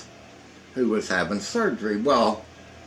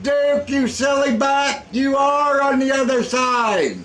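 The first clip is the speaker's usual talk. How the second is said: high, loud mocking shout; imitating Rusty scolding; 5 dB louder than usual. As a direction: yell at a silly friend.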